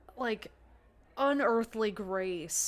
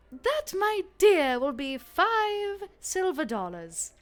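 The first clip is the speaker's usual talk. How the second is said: high, slow and louder, savouring each word; slow teasing barmaid voice; sultry barmaid; 8 semitones higher, 6 dB louder, slower than usual. Acted. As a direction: drawl out the price like a flirty saloon keeper. GM voicing bartender price.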